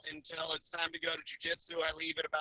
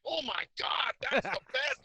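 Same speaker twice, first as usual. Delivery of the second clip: high, delighted mock gush; excited friend; 8 semitones higher, 3 dB louder than usual.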